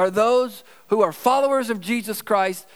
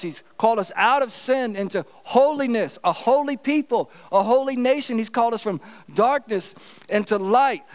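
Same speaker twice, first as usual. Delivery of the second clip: preaching; 4 semitones higher than usual. Acted. Rising preaching on holiness.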